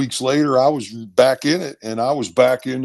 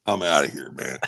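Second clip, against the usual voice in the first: low, defeated grumble; mildly acted giving up, pitched down; retold dejection; 10 semitones lower, 6 dB quieter than usual. Retells giving up in locker room.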